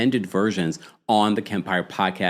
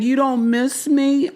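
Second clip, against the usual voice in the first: high, slow, plaintive mock pleading; imitating Angela; slower than usual. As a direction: whine it like a lonely wife. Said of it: "she's like".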